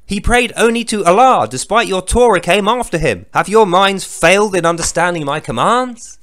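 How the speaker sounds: loud, solemn, hammed-up holy proclamation; booming scripture reading. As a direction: thunder the verse like a mock prophet. Reads the last verse, declaiming.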